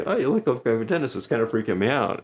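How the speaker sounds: stage joke line. Shown as a demonstration. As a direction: comedian repeating the dentist joke to a volunteer, teasing. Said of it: "So I say".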